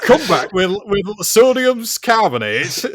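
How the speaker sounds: high, loud comic Yorkshire voice; slow, hammed-up Northern English; mock Yorkshire accent. Continues the silly Yorkshire bit.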